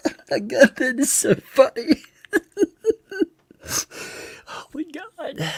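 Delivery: high, helpless laughter through words; can't stop laughing; laughing hard. Real, not acted. Cracking up at the video.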